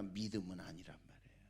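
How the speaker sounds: quick hushed whisper, fast. Real, not acted. 회중에게 조용히 강조하며, 빠른 속삭임. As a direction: drop to a fast whisper for effect.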